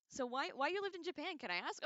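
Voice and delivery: high, loud, eager reading of a question; bright eager read from chat; excited chat question. Real, not acted. Reads a chat question aloud.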